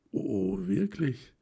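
slightly hoarse, slow stunned gasp of words; a little hoarse. A narrator's reading. Helma hearing of the engagement, a little hoarse. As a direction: push it out a bit husky, caught off guard. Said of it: "stieß Helma ein wenig heiser hervor".

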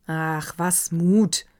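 slow, irritated grumble; grumbled crossly. Acted. Karl Erdmann grumbling at the doctor, slow and cross. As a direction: brush the word aside with annoyance. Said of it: "brummte Karl Erdmann ärgerlich".

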